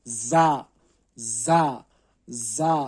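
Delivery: زا called out loud and clear; teacher drilling زا for students; letter drill. Shown as a demonstration. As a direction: sound out زا crisply. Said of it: "آگے".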